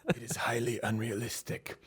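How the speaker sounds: slow, softened action star impression; Steven Seagal. Host mimicking an action star objecting to a script, slow soft impression. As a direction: object slowly, murmuring with self-importance. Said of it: "He was like".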